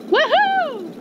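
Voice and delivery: cheering whoop. Acted. Thrilled to get invited to the party, high cheering whoop. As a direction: whoop like you just got invited.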